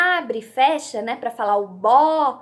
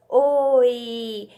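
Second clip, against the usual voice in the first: OI stretched into one long glide; drawn-out blend; 3 dB louder than usual. Shown as a demonstration. Teacher showing children a little word, "oi" drawn out. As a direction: draw out "oi" slowly, joining the vowels. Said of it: "eu formo a palavrinha".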